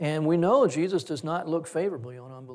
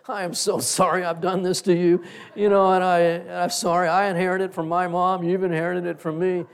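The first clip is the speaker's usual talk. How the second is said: louder retold apology; 3 semitones higher, 7 dB louder than usual. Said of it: "I went".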